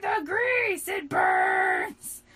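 high, loud dramatic cry; hammed-up wail. Riku wailing about grease in his hair, hammed-up cry. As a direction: wail over the ruined hair.

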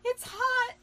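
high little squeak. Acted. Hillary warning about hot pizza, high squeak. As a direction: squeak it out like a mouse. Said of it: "squeaked Hillary".

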